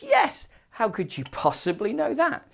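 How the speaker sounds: high, loud astonished question; acted amazement. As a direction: play astonished at being found out, pitched right up. Second character echoes the amazement.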